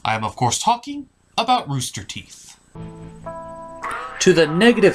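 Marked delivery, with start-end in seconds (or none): none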